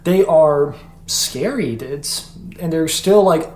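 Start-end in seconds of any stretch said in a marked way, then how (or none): none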